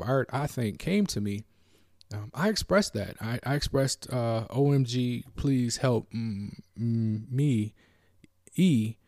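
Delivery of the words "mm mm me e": usual speaking voice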